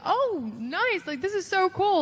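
high-pitched, thrilled reaction; acted delight. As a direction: replay being pleasantly bowled over by good news. Retold delight at getting GM job.

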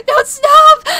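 high, loud cry of don't stop. Acted. Pleas build to a cry.

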